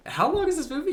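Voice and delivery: high-pitched incredulous question; retold exasperation. Moviegoer recalling a thought thirty minutes in, exasperated and high-pitched.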